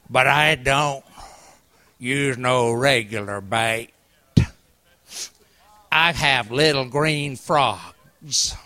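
put-on low, slow old Appalachian man. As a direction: drawl it like an old mountain fisherman. Old fisherman voiced in story.

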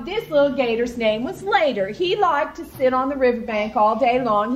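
plain reading voice

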